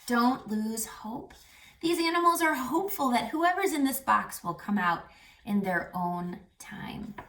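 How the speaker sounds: usual speaking voice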